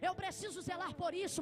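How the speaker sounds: normal talking voice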